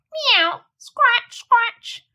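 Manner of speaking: high meow and scratch; scratching cat. A narrator's reading. Simpkin scratching at the window, high mew. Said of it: "scuffled Simpkin".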